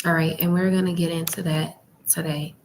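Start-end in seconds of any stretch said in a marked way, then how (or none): none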